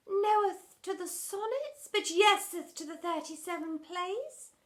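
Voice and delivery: high hopeful question. Acted. Drama teacher hopefully bargaining with headmistress, high. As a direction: ask it with hopeful pleading. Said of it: "asked Miss Palaver hopefully".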